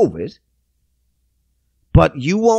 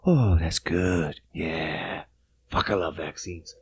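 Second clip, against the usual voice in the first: very soft, low, slow fake moan; mock afterglow; 11 semitones lower, 10 dB quieter, slower than usual. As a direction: sink into hushed, hammed-up satisfaction. Mock sex scene winding down.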